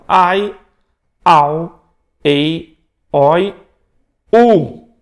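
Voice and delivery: "ai", "au", "ei", "oi" each as one gliding syllable, loud; "u" pronounced as a loud clear oo; diphthong drill. Shown as a demonstration. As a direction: sound out "ai, au, ei, oi, u" clearly for the class. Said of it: "numa mesma emissão de voz".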